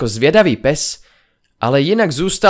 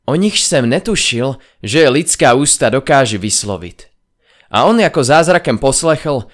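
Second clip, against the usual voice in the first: usual speaking voice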